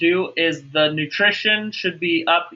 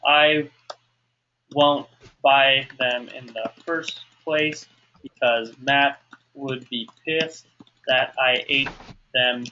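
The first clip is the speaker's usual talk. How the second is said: dictating while writing; 4 semitones lower than usual. Shown as a demonstration. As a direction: leave gaps as the pen catches up. Writing the answer out aloud.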